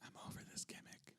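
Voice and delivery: whispered complaint, very soft; playful whisper. Pretend. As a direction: whisper it as if in a library. Joins in the librarian whisper.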